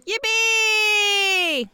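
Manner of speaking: long drawn-out yippee; crew cheer. Acted. Crew member cheering a success, gleeful. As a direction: stretch it out in celebration. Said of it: "This is the crew cheers".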